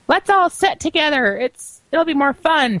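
high, eager friend. Retold or imitated. Anna asking the group to sit together, high and slow. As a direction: pitch it up and sweet.

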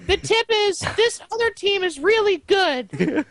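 very high, slow whiny princess voice; squeaky cartoon princess mockery; Lumpy Space Princess voice. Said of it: "Was that the Lumpy Space Princess voice?".

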